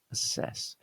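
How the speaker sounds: "assess" slowly, double S as one hiss-like S; single S sound. Shown as a demonstration. Teacher showing how double S is pronounced, "assess" with single S. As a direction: say "assess" softly and slowly, uh-SESS. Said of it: "sounds like a single S".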